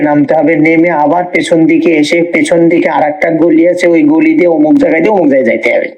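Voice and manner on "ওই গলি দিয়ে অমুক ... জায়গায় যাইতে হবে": fast rattled directions. Retold or imitated. Rattling off mock road directions.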